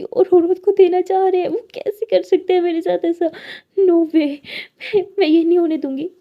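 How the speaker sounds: high, loud, tearful outburst; crying, furious. A narrator's reading. Lily cries to her friend about her father, loud and tearful. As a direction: wail it through tears and rage. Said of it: "तो lily फिर से रोने लग जाती है".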